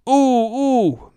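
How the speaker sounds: high, drawn-out excited oohs; acted eagerness. Gabby suddenly wanting a pretzel, high eager oohs. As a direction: pipe up high with a sudden idea.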